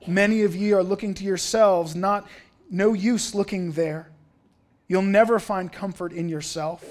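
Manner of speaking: usual speaking voice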